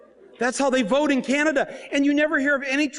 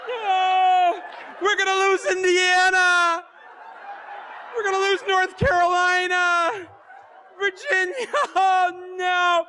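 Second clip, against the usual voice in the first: panicked wail; 7 semitones higher, much slower than usual. Retold or imitated. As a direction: cry out like the election is lost. Retold panic in the booth.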